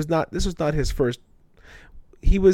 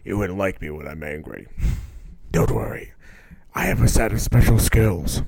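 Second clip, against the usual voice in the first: Liam Neeson impression; 5 semitones lower than usual. Friend recasting the quote as an action-film threat, low menacing impression. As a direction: menace in a much lower register. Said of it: "sounds like something that Liam Neeson would say".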